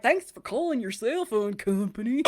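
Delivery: hammed-up country twang; hee-haw twang. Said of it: "It's not hee-haw. I'm not like".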